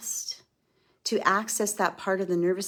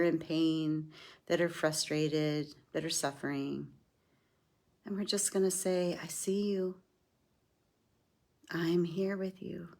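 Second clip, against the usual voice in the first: guided meditation. Acted. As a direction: guide them gently inward. Leads a self-compassion meditation.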